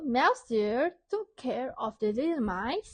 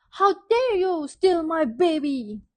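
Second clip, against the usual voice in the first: loud, higher furious outburst; angry shout; 5 semitones higher, 7 dB louder than usual. Acted. Daddy Mouse shouts at Frog over his baby, angry. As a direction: yell it at the thief who took your baby. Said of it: "Daddy Mouse shouted".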